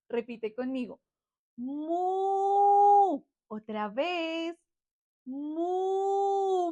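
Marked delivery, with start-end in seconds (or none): 1.5-3.2 high, loud, long moo; imitación de vaca. Shown as a demonstration. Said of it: "¿Cómo hace la vaca?". 5.2-6.7 high, drawn-out moo; imitación de vaca. Shown as a demonstration. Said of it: "¿Cómo hace la vaca?".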